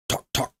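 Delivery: soft mouth knocking; gavel knocks. Shown as a demonstration. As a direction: tap out a gavel.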